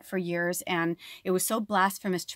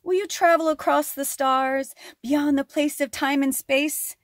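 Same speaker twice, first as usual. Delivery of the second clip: higher, louder mystic lizard voice; lizard spirit speaking; 8 semitones higher, 4 dB louder than usual. Pretend. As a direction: invite them across the stars. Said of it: "So the lizard says".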